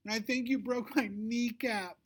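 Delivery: high, slow dramatic whine; bully girl faking a whimper; hammed-up whimper. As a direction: overplay the pain for sympathy. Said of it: "Victoria whimpers".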